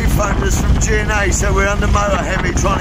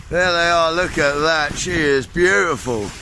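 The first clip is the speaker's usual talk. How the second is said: ordinary delivery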